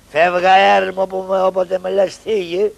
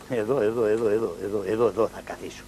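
lower, softer quick muttering; low soft fast muttering; 9 semitones lower, 8 dB quieter, faster than usual. Acted. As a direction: mutter to yourself while hiding. Muttering to himself, hiding.

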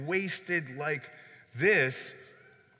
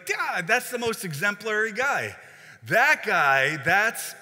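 excited; 4 semitones higher, 6 dB louder than usual. Retold or imitated. Disciples praise Judas as treasurer.

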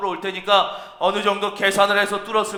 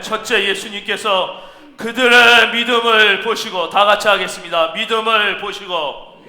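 ordinary delivery

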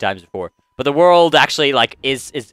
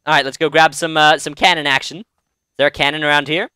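ordinary delivery

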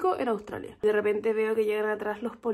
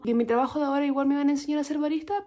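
usual speaking voice

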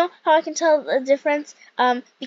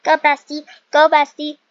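loud bestie cheer; punchy, loud hype chant; 3 semitones higher, 9 dB louder than usual. Real, not acted. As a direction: cheer your friend on loudly. Cheering on friend in game.

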